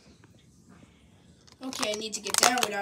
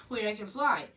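ordinary talk

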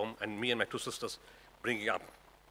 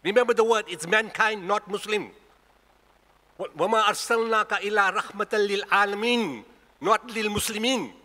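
high, loud, emphatic sermon delivery; full-force preaching; 7 semitones higher, 6 dB louder, much faster than usual. Real, not acted. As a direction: drive the point home to the congregation.

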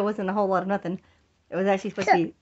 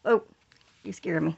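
usual speaking voice

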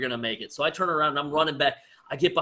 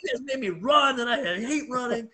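hammed-up indignant whine, pitched way up; mock mad; 11 semitones higher than usual. Coach griping at runners who made him run, mock-angry and high. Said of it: "I was so mad".

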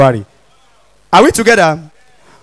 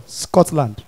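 ordinary delivery

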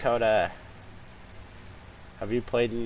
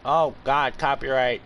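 alarmed; 4 semitones higher, 6 dB louder than usual. Real, not acted. Alarmed outburst.

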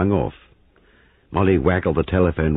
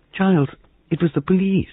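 frightened. Acted. Molly frightened tells Giles.

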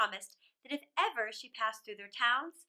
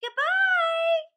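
high, loud farewell call; a loud, high, cheerful goodbye; 12 dB louder than usual. Acted. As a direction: call it out across the distance. Everyone calls farewell at parting.

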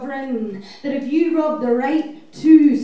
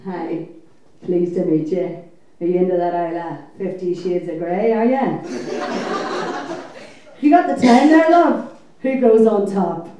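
lower, mock-chummy probing questions; nosy interrogating bloke; 6 semitones lower, 5 dB louder than usual. Comic mimics a stranger quizzing her about sex, leering. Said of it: "got this kind of interrogation".

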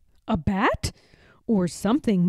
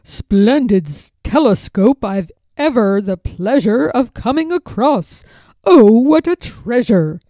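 louder, gloating rush of delight; greedy delight; 6 dB louder than usual. A narrator's reading. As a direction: swell with greed at the prize. Lord gloats over treasure.

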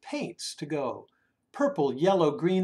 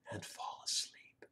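sleepy whisper; 16 dB quieter than usual. A narrator's reading. Telling how the boys fall asleep, whispered softly. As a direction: lull it down to sleep.

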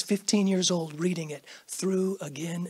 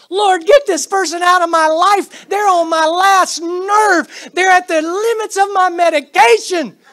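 loud, high, fed-up complaint to God; exasperated prayer; 10 semitones higher, 9 dB louder than usual. Retold or imitated. Churchgoer praying away a difficult classmate, loud and exasperated. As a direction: vent it at the top of your voice.

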